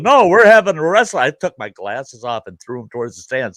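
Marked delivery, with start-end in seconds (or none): 0.0-1.2 high, loud and fast challenge; yelling that the fight is on; mock defiant shout; retold shout. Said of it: "And I'm going".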